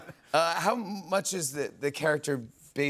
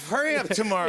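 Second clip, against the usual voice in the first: movie trailer voice; 8 semitones higher, 5 dB louder than usual. Pretend. Host mock movie trailer intro.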